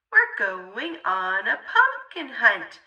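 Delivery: plain reading voice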